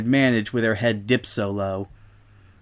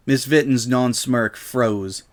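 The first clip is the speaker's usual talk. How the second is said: plain reading voice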